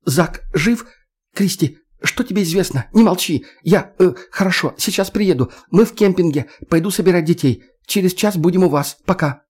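high, tense, alarmed woman's voice; urgent and frightened on the phone; voice trembling with tension. Acted. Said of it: "голос спортсменки дрожал от напряжения".

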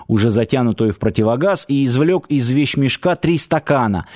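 plain reading voice

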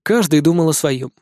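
ordinary delivery, nothing marked

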